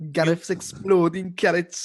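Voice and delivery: slow, high Welsh attempt gone Geordie; failed Welsh accent. Shown as a demonstration. Copying co-host's starter phrase, Geordie-sounding Welsh. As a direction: aim for Welsh, land in Geordie. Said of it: "You sound more Geordie now".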